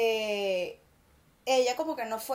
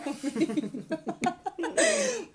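laugh; 5 semitones higher than usual. Real, not acted. Laughing at "cabeza huevo.

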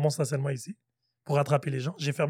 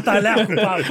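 laughing while quoting; 2 semitones higher, 4 dB louder than usual. Retold or imitated. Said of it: "Il m'a dit".

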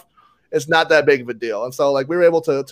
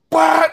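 high, sudden "bah" burst; imitated gunfire; 12 semitones higher, 4 dB louder than usual. Player imitates opponent firing at him, sudden burst. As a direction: blurt out the shot as a noise. Imitates the shooter's gun going off.

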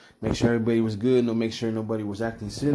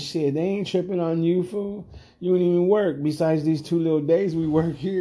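higher-pitched exasperated telling-off; retold put-down; 6 semitones higher than usual. Bouncer telling his partner nobody is impressed, exasperated. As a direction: talk him down with a raised pitch.

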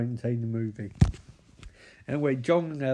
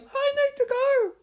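very high hammed-up squeal; mock panicked squeal; 4 dB louder than usual. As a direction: squeal it in a comic falsetto. Acting John's sudden panicked exit.

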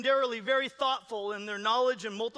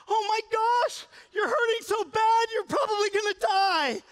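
much higher, louder, half-crying shout; an overwrought ER worker wailing at the patient; dramatic panic; 13 semitones higher, 8 dB louder than usual. Retold or imitated. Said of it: "a four's gonna be like".

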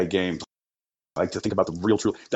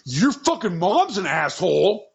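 bratty mock Mason; 9 semitones higher than usual. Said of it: "And Mason's like".

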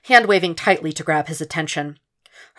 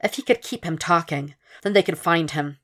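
ordinary delivery

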